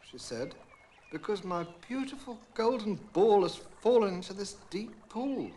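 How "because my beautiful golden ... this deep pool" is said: higher, louder plaintive princess voice; a girl pleading over her lost toy; lightly voiced princess in mild distress; upset young princess. A narrator's reading. Princess explains her loss.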